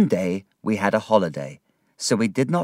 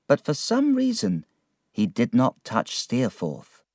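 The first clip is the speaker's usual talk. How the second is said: plain reading voice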